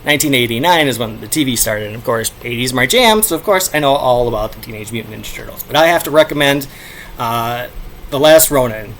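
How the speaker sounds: ordinary talk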